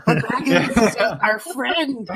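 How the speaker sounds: high, drawn-out playful protest; mock pleading argument. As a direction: stretch it out high and loud, half laughing. Jokingly acting out the argument.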